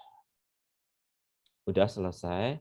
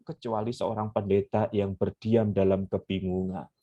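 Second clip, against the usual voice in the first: usual speaking voice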